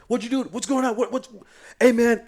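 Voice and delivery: high, loud, stammering outburst; retold yelling. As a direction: yell at them in a panic. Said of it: "I'm yelling".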